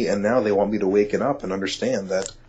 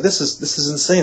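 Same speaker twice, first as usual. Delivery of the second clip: excited disbelief; 7 semitones higher, 4 dB louder than usual. Retold or imitated. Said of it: "I turned to her and said".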